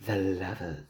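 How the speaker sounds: a low eerie whisper of the Lovers; spooky low voice. Acted. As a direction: intone it like a spooky fortune teller. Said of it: "I don't like it when I do that voice".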